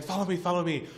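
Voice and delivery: high, loud beckoning; acted insistent call to follow; imitated eager urging. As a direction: urge them along like an excited guide. Soldier urging him along.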